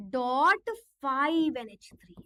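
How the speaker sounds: drawn out, each symbol spaced; stretched dictation of the formula; slow dictation. Shown as a demonstration. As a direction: dictate slowly for copying. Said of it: "CO C L three".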